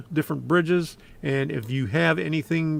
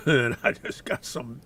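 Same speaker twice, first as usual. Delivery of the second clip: throat catching on dust; coughing through a dusty throat; 4 semitones lower than usual. Real, not acted. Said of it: "dust in my throat".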